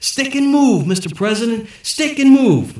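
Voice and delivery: loud, high boxing coach calls; barking corner man. A narrator's reading. The secretary coaches the president from the corner, loud and high. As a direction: shout ringside advice. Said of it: "barking advice like any good corner man".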